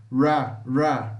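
ra with an English R; isolated ra syllables, English R; English R sound. Shown as a demonstration. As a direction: demonstrate ra, the R never said as H. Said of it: "temos que pronunciar dessa forma".

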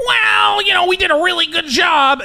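hammed-up high-pitched impression; mock Maddox. Host mimics the podcaster praising his own show, mocking high whine. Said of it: "it's just Maddox going".

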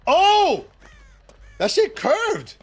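loud, high disbelief; real high-pitched shock; shocked outburst. Real, not acted. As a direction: yelp it in astonishment. Shocked by a curving shot.

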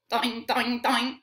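springy mock bounce noises; bouncy toing sounds. Shown as a demonstration. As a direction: make each toing hop like a jump. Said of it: "You jump".

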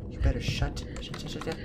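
low unvoiced whisper fading away; hissed whisper. Gamer whispering at the crying ghost girl to shut up.

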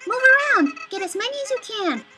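high bright call to move; excited game character. Acted. Flower urging the player to collect pellets, eager. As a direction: urge the player to grab them all.

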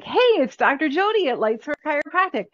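breezy sisterly hello, on the phone; casual friendly greeting. Shown as a demonstration. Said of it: "I'm everybody's sister. I'm everybody's B F F".

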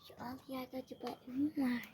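soft unvoiced whisper. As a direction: whisper the question while sneaking. Child sneaking in game.

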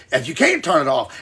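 ordinary talk, nothing marked

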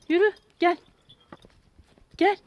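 ordinary talk

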